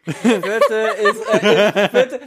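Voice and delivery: laughing. Real, not acted. Laughing while listing.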